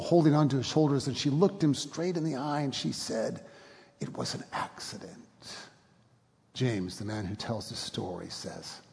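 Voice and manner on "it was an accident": nearly whispered; hushed forgiveness. A narrator's reading. Widow to the prisoner, whispered forgiveness. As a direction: breathe it out, barely voiced.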